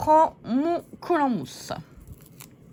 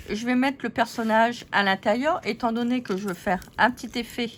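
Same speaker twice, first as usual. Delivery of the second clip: ordinary talk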